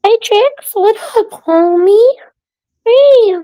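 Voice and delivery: very high squeaky hello; excited pet greeting. Real, not acted.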